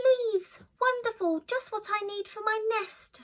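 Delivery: a greedy, delighted little squirrel; excited squirrel. A narrator's reading. Squirrel grabbing leaves for its nest, overjoyed. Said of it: "said a squirrel".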